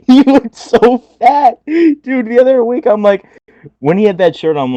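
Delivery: high, laughing through the words. Real, not acted. Podcaster laughing about a wrestler looking fat, high. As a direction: giggle while telling it.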